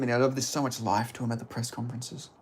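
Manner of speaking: ordinary talk, nothing marked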